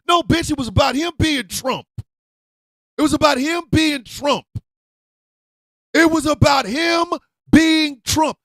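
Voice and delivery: shouting it over and over, high and loud; furious shout. Real, not acted. Ranting at Trump voters, furious shout. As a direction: hammer the point home in a rage.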